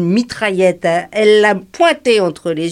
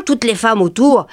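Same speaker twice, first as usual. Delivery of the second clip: ordinary talk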